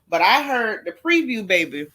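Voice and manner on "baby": normal talking voice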